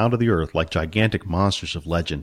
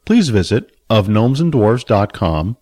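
louder, clear closing announcement; audiobook outro; 5 dB louder than usual. Acted. Narrator thanking listeners of Billy Barbarian, announcer read.

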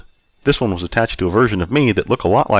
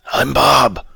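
put-on higher drunkard character voice; destitute drunk; 7 semitones higher, 4 dB louder than usual. A narrator's reading. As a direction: announce it as the down-and-out. Destitute drunk introduces himself.

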